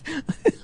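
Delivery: a quick, soft real laugh; short laugh. Real, not acted. Host scoffing at the UN kicking America out, short laugh. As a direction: give a brief amused laugh.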